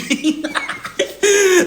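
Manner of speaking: high, real cackle of laughter; helpless laugh. Real, not acted. Storyteller cracking up at old lady's nerve, real laughing. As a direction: lose it laughing.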